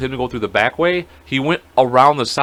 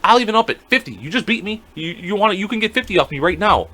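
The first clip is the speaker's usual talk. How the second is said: higher-pitched, worked-up goading; furious loser retold; 6 semitones higher than usual. Beaten player raises the bet on his opponent, mock furious. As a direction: imitate a sore loser pressing for a rematch. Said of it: "Now he's fucking furious".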